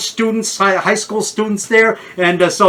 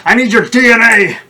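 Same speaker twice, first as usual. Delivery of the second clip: mad scientist; 5 semitones higher, 4 dB louder than usual. Retold or imitated. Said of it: "I go".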